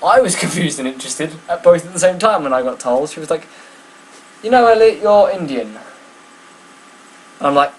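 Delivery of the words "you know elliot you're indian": high, loud announcing voice; mum revealing. Retold or imitated. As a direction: mum breaking the news, pitched high.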